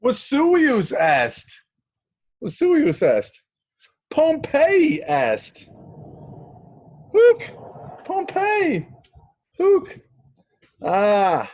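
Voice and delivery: high, loud drawn-out calls; theatrical exclaiming. Acted. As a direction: proclaim each Latin phrase with huge drama. Hammy Latin lesson opening.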